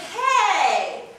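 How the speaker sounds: loud, high, drawn-out hey; big stagey greeting. Shown as a demonstration. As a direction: belt out an over-the-top hello. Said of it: "in real life, I'm not gonna be like".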